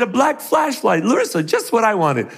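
high-pitched pleased gift reaction; mock delight. Father thanking daughter on Christmas Day, playful mock delight. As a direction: act thrilled by a small present.

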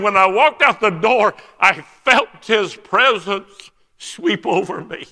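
imitated tearful testimony, high and loud; crying. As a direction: cry it out slowly, overwhelmed. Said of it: "And he's crying now".

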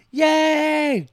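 put-on high, loud childlike yay; kid cheering. Little son cheering that dad is off tonight, high excited yay.

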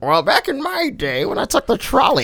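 lightly voiced cranky old man. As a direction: grumble like a grandpa reminiscing. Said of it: "Oh, come on, old man".

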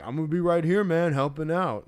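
loud, high put-on bro voice; big casual dude impression; bro guy voice. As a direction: imitate a bro, drawing out the end. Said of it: "the bro guy was like".

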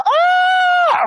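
husband reenacting his scared scream; high scream. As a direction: scream like you got scared out of your skin. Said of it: "What I did was this".

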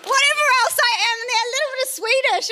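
high, laughing voice. Real, not acted. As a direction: squeeze the words out through laughter, pitched high. Laughing through ancestry list.

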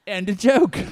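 put-on Australian accent. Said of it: "Australian stand-up character".

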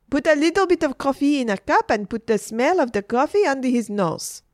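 high and loud, easing as she goes; brisk, dismissive Frenchwoman. A narrator's reading. Adeline tells Margaret he is only asleep, dismissive. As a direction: brush off the worry, then give orders.

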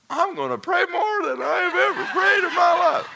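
very high, loud laughing vow; laughing exclamation. Retold or imitated. Pastor recalling his reaction to the story, high and laughing. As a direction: yelp it out half laughing.

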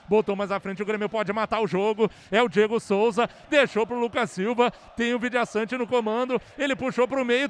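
fast, building radio call; play-by-play. Real, not acted. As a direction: speed up as the attack builds. Last-minute attack call.

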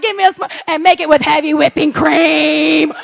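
slow, laughing, indulgent order; gleeful order. Retold or imitated. Mom ordering her treat, laughing gleefully. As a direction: draw it out into a laugh.

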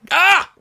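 short, high, loud yell; sharp yelp of frustration; frustrated yell. Real, not acted. Host failing to stop cursing, frustrated yell. Frustrated at swearing slip again.

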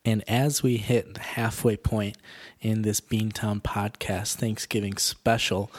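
ordinary delivery

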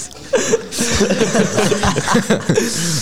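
long high-pitched laugh; real laughter. Real, not acted. Friend hears the drained plumber joke, real laughter. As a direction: crack up at the punchline.

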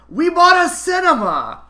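loud, high excited shout; giddy friend shouting across a theatre. Retold or imitated. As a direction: blurt it out giddy as a schoolgirl. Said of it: "shouted out".

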